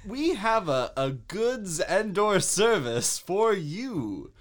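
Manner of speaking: hammed-up advert voice; mock ad announcer. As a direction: sell it like a parody commercial, stretching the words. Mock ad pitch for a generic product.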